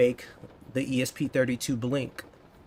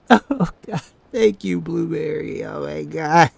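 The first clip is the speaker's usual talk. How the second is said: real delighted laughter; laughing; 3 semitones higher, 7 dB louder than usual. Real, not acted. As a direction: giggle through the thanks. Thanking new member, laughing.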